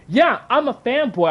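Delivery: high, emphatic defiant retort; indignant comeback, pitch jumping up. Real, not acted. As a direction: own the insult defiantly. Retorting to being called a fanboy.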